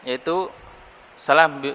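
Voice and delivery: ordinary talk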